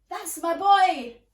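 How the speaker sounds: slow, loud proud call; proud shout. Acted. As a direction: cheer him on loudly. Proud pirate dad shouts.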